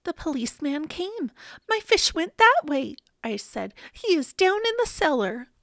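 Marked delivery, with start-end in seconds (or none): 1.7-2.9 higher, louder urgent pointing-out. A narrator's reading. Boy directs the policeman to his fish, urgent. 3.9-5.5 high-pitched urgent report. A narrator's reading. Boy tells policeman where fish is, urgent.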